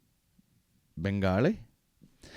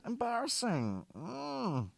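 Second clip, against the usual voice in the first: mock cringe; 14 semitones higher, 9 dB quieter, much slower than usual. Mocking The Rock's intro.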